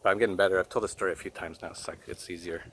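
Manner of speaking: soft, fading voice, close to crying; holding back tears. Real, not acted. Retelling his stepdaughter's question, fighting back tears. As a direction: keep it together as the emotion rises. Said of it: "I have a hard time saying this without crying".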